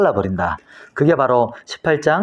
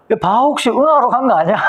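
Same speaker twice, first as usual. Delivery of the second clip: higher-pitched teasing jeer; acted taunt; 7 semitones higher than usual. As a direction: poke fun at them as Elijah, with a laugh just after. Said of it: "그래서 막 놀리면서 막". (놀리듯 높은 목소리로)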